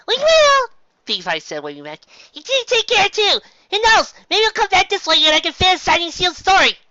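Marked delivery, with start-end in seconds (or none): 0.1-0.7 much higher, louder cheerful pony; bright pony voice. A narrator's reading. Pony calls back waving. 2.3-6.8 much higher, louder cheerful pony; bright pony voice. A narrator's reading. Same pony calls farewell.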